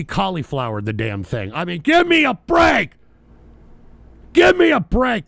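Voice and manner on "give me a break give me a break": loud, high-pitched outburst; exasperated shout. Real, not acted. Host fed up with the news story, exasperated shout.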